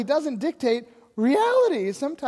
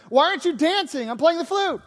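put-on loud, high complaint of a bored kid; mock yelling; 6 semitones higher, 5 dB louder than usual. Bored kid with a flute yells at passers-by, mock yelling. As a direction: yell at the kids who won't dance. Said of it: "you start yelling at them".

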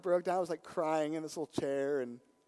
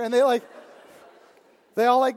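usual speaking voice

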